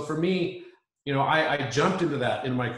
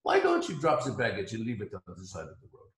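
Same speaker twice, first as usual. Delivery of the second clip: put-on quick, lower French-accented question; 4 semitones lower, faster than usual. French guy advising him to drop the baggage, in a fast French accent. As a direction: ask it gently in a French accent. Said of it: "very heavy French accent".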